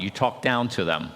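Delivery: ordinary talk, nothing marked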